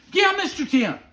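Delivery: high, loud mock child's reply; eager little boy. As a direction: pipe up like an excited kid. Retold child eagerly answering guide.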